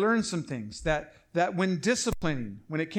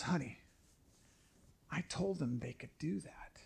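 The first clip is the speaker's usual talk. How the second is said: wife whispering; 3 semitones lower, 12 dB quieter than usual. Retold or imitated. Wife interrupting discipline, whispered.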